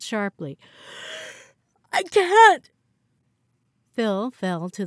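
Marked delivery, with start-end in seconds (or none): 1.9-2.7 much higher, much louder pained outburst; anguished cry. Acted. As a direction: cry out as pain hits. Man seized by sudden pain.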